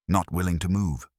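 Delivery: plain reading voice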